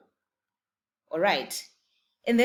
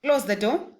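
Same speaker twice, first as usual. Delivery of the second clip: ordinary delivery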